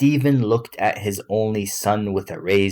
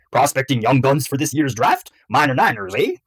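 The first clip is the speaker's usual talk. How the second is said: higher, confident Texan dad; 5 semitones higher, 4 dB louder than usual. A narrator's reading. Father asking son about the draft, confident. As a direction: quiz him with assurance. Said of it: "Stephen sounded confident".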